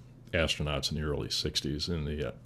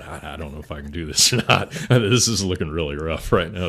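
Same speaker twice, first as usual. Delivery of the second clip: nervous laughing thought; 7 dB louder than usual. Retold or imitated. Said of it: "I thought".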